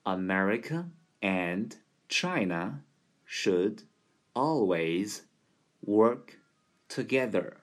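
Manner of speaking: word by word, short pauses; slow demo. Shown as a demonstration. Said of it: "再来展示一遍".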